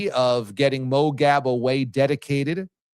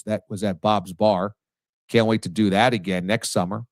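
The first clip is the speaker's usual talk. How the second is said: normal talking voice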